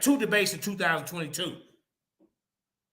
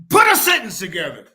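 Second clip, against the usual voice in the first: loud, sudden outburst; real raised-voice order; exasperated demand; 8 dB louder than usual. Real, not acted. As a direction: burst out at your opponent, fed up. Barking at opponent mocked for speech.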